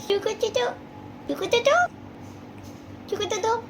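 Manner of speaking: high, soft cooing question. Real, not acted. Mother cooing at her baby.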